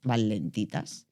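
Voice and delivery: slow dropping demo of slow vibration; slow and low. Shown as a demonstration. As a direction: drag van lentitas slowly and softly.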